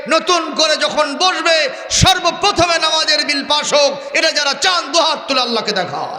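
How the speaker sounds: high, impassioned sermon cry; full-force preaching. Real, not acted. Preacher rousing the crowd to raise hands, full-force preaching.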